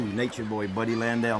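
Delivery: ordinary delivery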